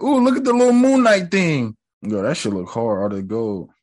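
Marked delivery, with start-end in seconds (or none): 0.0-1.8 high, delighted coo; excited squeal. Retold or imitated. Spotting the Moon Knight relic, high excited coo. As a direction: gush like a kid at a toy. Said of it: "And I was like".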